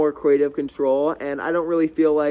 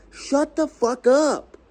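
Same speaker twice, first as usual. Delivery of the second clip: much higher, slow, fed-up snap; angry outburst; 10 semitones higher, slower than usual. Real, not acted. Vlogger ranting about fake small talk, angry outburst. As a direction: blurt it out, truly fed up. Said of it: "I'm getting so angry about this".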